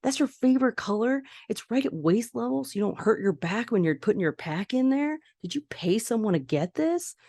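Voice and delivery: dad selling the blue cubby with over-the-top enthusiasm; hyped-up dad. Retold or imitated. Dad hyping up nervous son.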